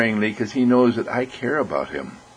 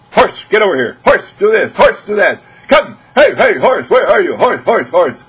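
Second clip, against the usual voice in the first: loud, high barked commands at the horse; bossy yelling; 11 semitones higher, 11 dB louder than usual. Acted. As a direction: shout orders like an impatient owner.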